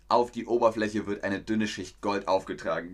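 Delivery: usual speaking voice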